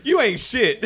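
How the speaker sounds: high, loud teasing jab; imitating Ann. Ann teasing him when they hang out, loud laughing jab. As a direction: tease like his friend Ann. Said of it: "this is all I ever hear from Ann".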